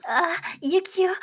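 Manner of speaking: usual speaking voice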